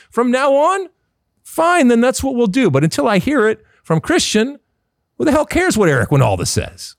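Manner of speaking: high-pitched, insistent protest; worked-up rant. Real, not acted. Host defending a player's chosen name, worked-up rant. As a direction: argue it indignantly, pitch climbing well up.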